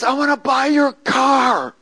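imitating a high, drawn-out holler; slow, high call across a distance; retold yell. Big man yelling an offer into the woods, imitated yell. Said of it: "he starts yelling to me".

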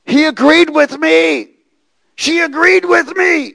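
high, loud, slow demon cry. Retold or imitated. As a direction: voice the demons calling out eerily. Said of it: "demons were crying out to me saying".

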